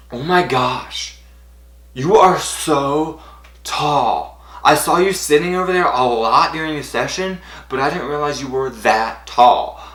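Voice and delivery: imitated wonder, high and emphatic; amazed. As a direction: stretch it out in astonishment. Star amazed at fan's height.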